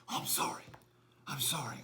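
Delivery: hushed sorry; soft whispered apology. Acted. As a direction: whisper it sheepishly. Host apologizing after outburst.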